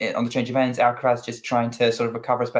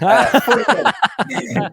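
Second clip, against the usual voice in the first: mock British accent, higher and slower, laughing through it; 11 semitones higher, 6 dB louder, much slower than usual. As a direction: put on a jokey British accent for the score. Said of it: "I will say that in my British accent".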